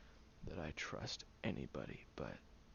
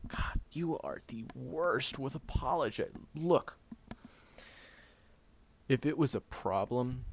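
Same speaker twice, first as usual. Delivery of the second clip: louder, higher, fed up; exasperated outburst; 10 semitones higher, 8 dB louder than usual. Acted. Friend over-apologizing, exasperated loud cut-off. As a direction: cut them off in frustration.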